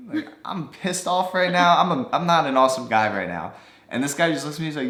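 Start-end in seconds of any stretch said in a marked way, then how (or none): 0.1-3.5 raised, louder retold irritation; mock pissed off. To the stranger who called him awesome, mock pissed off. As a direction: snap back with a hammed-up grumble. Retelling anger at stranger's praise.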